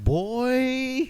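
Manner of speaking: high, loud imitation of a stunned reaction; exaggerated high-pitched holler; mock exclamation. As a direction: holler it like a roomful of teammates reacting. Acts out players reacting in locker room.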